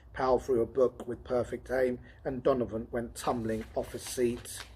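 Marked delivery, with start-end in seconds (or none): none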